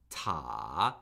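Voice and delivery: "ta" dipping low then rising; third tone. Shown as a demonstration. Teacher modelling tones for repeating, "ta" falling then rising. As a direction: start "ta" low, fall, then swing back up. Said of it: "it falls and then rises".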